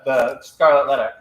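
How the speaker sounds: ordinary talk, nothing marked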